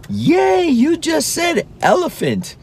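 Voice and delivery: put-on very high, delighted cheer for a toddler; cheering praise. Dad cheering his toddler for saying a word, high and thrilled. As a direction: cheer him on like he just won. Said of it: "So we applaud".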